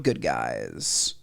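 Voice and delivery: low, slow, drawn-out promo code; slow drawn-out sign-off. Acted.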